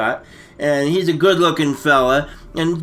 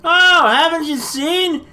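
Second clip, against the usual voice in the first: excited; 7 semitones higher than usual. Acted. Mock-excited show opener.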